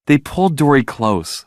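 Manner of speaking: ordinary delivery, nothing marked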